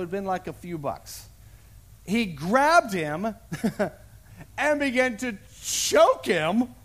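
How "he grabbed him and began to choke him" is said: drawn-out, louder, building menace; slow, heavy storytelling; slow dramatic. A narrator's reading. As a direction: stretch each word as the grip tightens. Dramatic retelling of choking.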